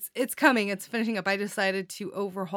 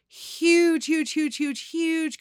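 excited; 8 semitones higher, 4 dB louder, much slower than usual. Real, not acted. Announcing contest winners.

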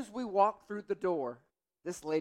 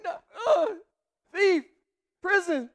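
high, loud broken-off words in shock; shocked sputter; 13 semitones higher, 6 dB louder than usual. Retold or imitated. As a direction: sputter like you can't form a sentence. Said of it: "I can't put words together".

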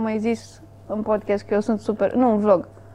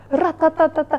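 retold yell; 6 semitones higher than usual. Someone yells from another corner of the terrace, mock holler. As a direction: imitate someone hollering nonsense from afar. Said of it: "a țipat".

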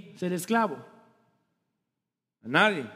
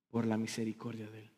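"Por la misericordia de Él" dropped to a hushed, much softer near-whisper; 10 semitones lower, 14 dB quieter, faster than usual. Acted. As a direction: pastor softly marvelling at "Por la misericordia de Él". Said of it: "repeated line".

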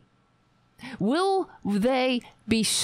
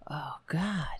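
whisper; 6 semitones lower, 6 dB quieter than usual. Real, not acted. Reacting after prank call.

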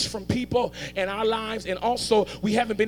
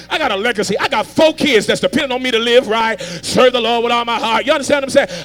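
high, loud shouted preaching; full-force preaching; 7 semitones higher, 12 dB louder than usual. Real, not acted. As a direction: hammer it home to the crowd.

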